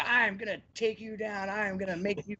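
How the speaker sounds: mock macho boasting. Imitating rematch challenger threatening his opponent, mock macho. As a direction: puff it up like a cocky challenger. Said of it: "comes out all macho and everything".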